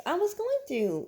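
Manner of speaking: high-pitched defensive retort; exasperated protest. Real, not acted. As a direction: protest at being hurried along. Bickering over who talks on camera.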